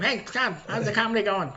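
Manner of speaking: high-pitched pestering buddy voice; Boston coworker impression. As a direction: imitate the guy in your face. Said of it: "But he's like".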